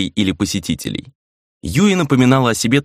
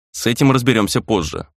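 ordinary delivery, nothing marked

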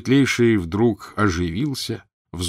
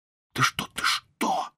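frightened hushed whisper; slower than usual. A narrator's reading. Father hushing his son before the prince, frightened whisper. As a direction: hiss it under your breath. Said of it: "зашептал папенька".

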